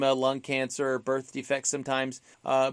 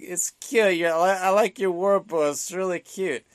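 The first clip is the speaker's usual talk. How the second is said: higher mock drunk voice; Martin imitation; 5 semitones higher, 3 dB louder than usual. Imitating Martin teasing Zaroff, higher and mocking. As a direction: ham up the silly drunk. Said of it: "It just sounds like".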